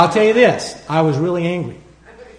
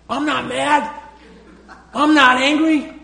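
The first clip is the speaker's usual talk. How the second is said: high-pitched, indignant mock protest; angry denial; 9 semitones higher than usual. Preacher replaying his denial to the Lord, angrily indignant. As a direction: act out denying anger while obviously mad. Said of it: "since you're so mad".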